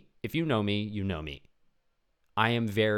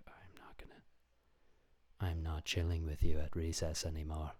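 whisper; 3 semitones lower, 5 dB quieter than usual. Retold or imitated. Kid telling friend off at school.

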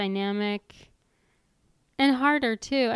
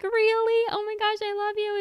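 much higher and louder, quick giddy gush; imitating giddy disbelief; 12 semitones higher, 3 dB louder than usual. Imitating Brie answering his I love you, high and giddy. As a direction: squeal it like she can't believe it. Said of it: "She's like".

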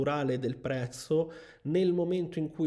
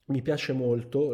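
ordinary talk

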